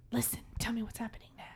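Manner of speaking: fast, hushed whisper. Retold or imitated. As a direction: whisper it urgently into the phone. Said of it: "even when she's whispering".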